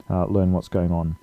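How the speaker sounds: ordinary talk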